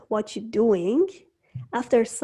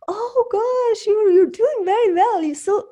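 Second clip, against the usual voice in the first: high-pitched mock amazement, louder mid-phrase; gushing admirer; 10 semitones higher, 6 dB louder than usual. Followers praising the growing YouTube channel, gushing in mock amazement. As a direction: gush like an impressed onlooker, pitched way up.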